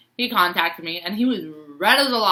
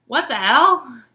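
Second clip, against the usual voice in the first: mock shock; 6 semitones higher, 6 dB louder, much slower than usual. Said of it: "I was like".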